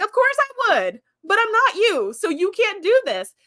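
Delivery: lightly voiced captain, insistent; firm retort. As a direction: set him straight with emphasis. Geordi firmly refuses Chakotay.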